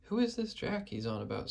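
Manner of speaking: ordinary delivery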